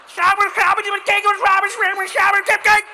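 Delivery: high, very loud mock-Dutch yelling; hammed-up outrage. Cyclist coming at you full speed, hammed-up yelling. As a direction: lose it in a torrent of yelped gibberish. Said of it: "people lose their shit".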